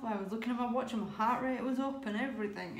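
normal talking voice

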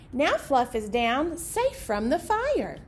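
ordinary talk

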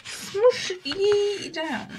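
hammed-up mock archaic drawl; dodgy accent. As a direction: draw it out in a silly mock-olde voice. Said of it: "Again with the dodgy accents".

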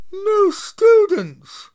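very high, loud and slow booming friar; a huge jolly friar calling out. Acted. Friar speaks.

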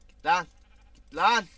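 loud panicked yell; shouting at enemies. Real, not acted. Gamer fighting off zombies, yelling at them. Fending off attackers.

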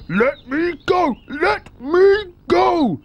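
panicked yell. A narrator's reading. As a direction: wail it while dangling upside down. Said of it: "yelled the enormous crocodile".

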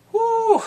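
high, loud whoop; excited whoop. Real, not acted. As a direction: let out a big excited whoop. Excited outburst.

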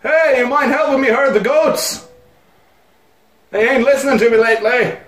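high, loud voice-acted call; hollering farmhand. Farmhand asking Link to help herd goats, high holler. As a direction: call out as a goat herder.

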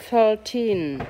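"Thirteen." with a blown th and a clear er; model "thirteen". Shown as a demonstration. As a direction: read "Thirteen." slowly for the child to copy. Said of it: "Nào, để mẹ đọc này". (mẹ đọc mẫu "Thirteen." thổi hơi chữ th)

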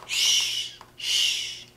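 very soft, drawn-out "shh"; hushing. Acted. Grandma hushes for sleeping bunnies, soft shushing. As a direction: hush gently, twice. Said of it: "abuelita dice".

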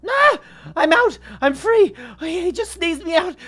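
loud, high shouting of relief; overjoyed relief. Pretend. Player freed from the shark shouting to viewers in loud relief. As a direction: yell it like you just escaped death.

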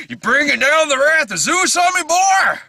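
high, hammy sword-and-sandal villain; mock villain taunt. Mocking the film's villain, a hammy taunt. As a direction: ham it up like a movie tyrant.

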